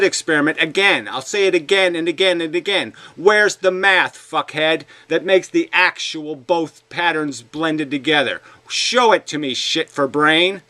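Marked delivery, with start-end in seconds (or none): none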